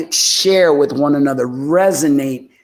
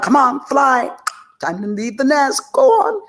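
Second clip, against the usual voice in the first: much higher, lightly voiced mother bird urging her chick; mother bird nudging; 10 semitones higher than usual. Mother bird pushing her chick from the nest, a playful higher coaxing voice. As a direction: coax the little bird out of the nest, rising to a squeak on go on.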